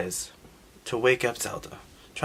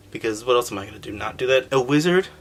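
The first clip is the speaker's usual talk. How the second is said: ordinary delivery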